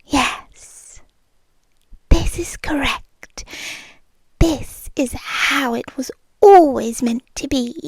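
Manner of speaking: a hushed, satisfied whisper; whispered thought. Retold or imitated. Recalling looking at you, a satisfied whisper. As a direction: breathe it like a private certainty. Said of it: "and I thought".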